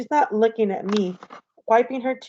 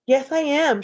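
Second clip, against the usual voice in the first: mock-tearful, higher-pitched insistence; upset and higher, a touch of a sob; sobbing; 6 semitones higher than usual. A narrator's reading. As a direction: insist through mild, acted tears. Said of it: "she sobs".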